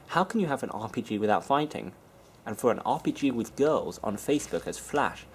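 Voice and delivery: ordinary delivery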